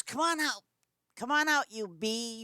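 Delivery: put-on high, loud taunt of an angry girl; taunting challenge. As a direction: call her out from the doorway. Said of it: "She goes".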